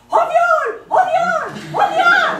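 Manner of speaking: very high, loud repeated scream, hammed up; mock cry for help. Woman faking an assault to lure a fireman, shrieked false alarm. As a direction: shriek the fake alarm at top pitch. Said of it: "et je crie".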